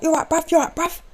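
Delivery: caught-out man greeting the door; nervous bloke voice. Retold or imitated. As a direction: squeak out a guilty hello. Stranger greets partner, caught out.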